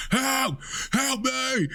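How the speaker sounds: high mock wail for help, kept soft; half-hearted imitation of a screamed help; mock screaming for help. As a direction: squeak out a fake cry for help. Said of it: "they're screaming".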